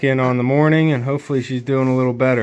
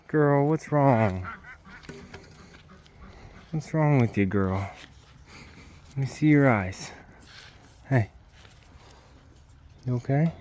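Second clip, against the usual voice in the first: soft worried comfort; 7 dB quieter than usual. Real, not acted. Owner to her sick goat, soft and worried. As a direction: hush your voice for a sick animal.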